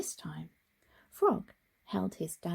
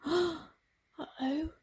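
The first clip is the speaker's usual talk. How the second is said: a touch of panic; 8 semitones higher than usual. Acted. Frog realises he is lost.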